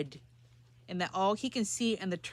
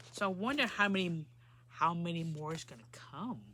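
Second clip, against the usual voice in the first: usual speaking voice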